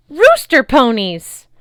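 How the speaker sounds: loud, high, slow delighted cry; amazed shout. A narrator's reading. Big boy amazed at rooster ponies, loud delighted cry. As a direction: shout it in wonder. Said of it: "Tyson said in amazement".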